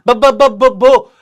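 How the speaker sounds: stuck on the first sound, b- b- b-; stammering. Acted. Stammering girl trying to say her name to the teacher. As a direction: act out a girl's nervous stammer. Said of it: "she began to stammer".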